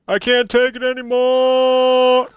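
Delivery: high, slow, drawn-out hammed-up wail; despairing cry. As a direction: wail it like a melodramatic breakdown. Fake despair in the game.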